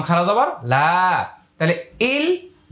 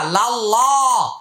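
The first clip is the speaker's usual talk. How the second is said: slow recitation; 3 semitones higher than usual. Shown as a demonstration. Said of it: "তাই এটার উচ্চারণ মোটা।".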